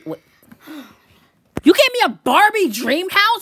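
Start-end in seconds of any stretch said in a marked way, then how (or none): 1.7-3.4 high, loud, drawn-out disbelief; incredulous outburst. Acted. Birthday kid reacting to a gift, incredulous outburst. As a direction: stretch out the outraged question.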